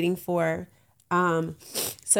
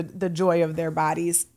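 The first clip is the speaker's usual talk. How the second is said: ordinary talk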